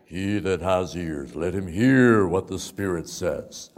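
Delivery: slow, swelling open-air preacher voice; booming preacher. Retold or imitated. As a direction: imitate a great preacher booming out scripture. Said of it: "he had such a booming voice".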